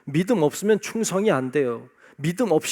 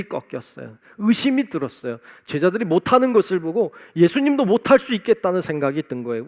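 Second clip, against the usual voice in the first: loud, fervent sermon voice; preaching; 7 dB louder than usual. Acted. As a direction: preach it out to the congregation. Preaching at full force. (힘차게 설교하듯)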